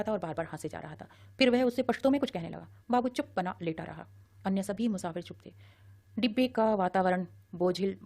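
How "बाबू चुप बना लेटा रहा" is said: usual speaking voice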